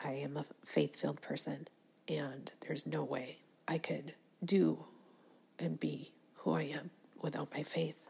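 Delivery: soft whisper of faith; hushed confession. Real, not acted. Speaker confiding her faith to listeners in a soft whisper. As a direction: confide it softly.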